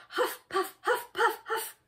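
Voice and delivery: short, high puffs of breath; huffing and puffing. A narrator's reading. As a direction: puff like a bear rushing home. Acting out bear rushing home.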